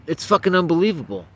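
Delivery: usual speaking voice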